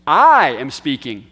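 "I" punched loud and long in "I am speaking"; stress on I. Shown as a demonstration. Teacher explaining the added pronoun, "I" strongly emphasized. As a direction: hit "I" hard, then let "am speaking" fall away. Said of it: "when that's done, it's for emphasis".

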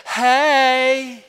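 high, loud, stretched Tennessee greeting; Tennessee girls. Retold or imitated. Preacher imitating Tennessee girls at the bus, high Tennessee holler. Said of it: "I heard some Tennessee girls, and they said".

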